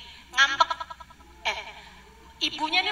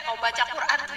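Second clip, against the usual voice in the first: normal talking voice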